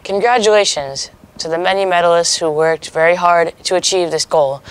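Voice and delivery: ordinary talk, nothing marked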